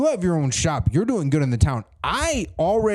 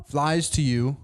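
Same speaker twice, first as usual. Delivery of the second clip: usual speaking voice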